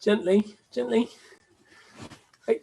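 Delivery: high cooed caution to a puppy; puppy-voice warning. Real, not acted. Owner to puppy biting an ear, high and cooing.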